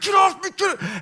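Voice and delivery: high, irritated shout to get off; frustrated yell. Retold or imitated. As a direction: snap at everyone piled on you. Frustrated kid in dogpile.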